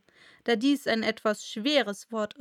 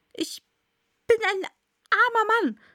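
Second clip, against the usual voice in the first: high, loud, halting plea; ängstlicher Hutmacher; 11 semitones higher, 6 dB louder than usual. Acted. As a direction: plead word by word in a high, frightened voice. Trembling Hatter pleads with King. (hoch, stockend und ängstlich flehend)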